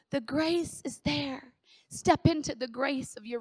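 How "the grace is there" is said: slow. Shown as a demonstration.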